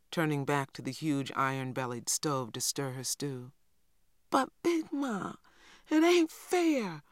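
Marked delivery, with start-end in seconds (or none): 4.3-7.0 put-on high, slow, drawn-out child's wail; a small boy wailing his protest, pitched high; wailing little boy. A narrator's reading. Little boy wails to grandmother that it isn't fair, high and slow. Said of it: "wailed Little Man".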